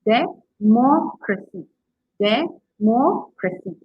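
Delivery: de... MOC... racy, very slow and broken up; democracy spelled out slowly by sound, weight on MOC; stress on third-last syllable. Shown as a demonstration. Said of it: "the third from last".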